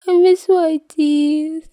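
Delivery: very high, loud and slow, moaning; miserable moan. Acted. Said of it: "Peter moans miserably".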